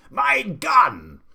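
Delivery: high, very loud roar; Duke's furious shout. A narrator's reading. Duke shouting to the gardener, furious. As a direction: roar for the gun. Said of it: "the Duke was shouting".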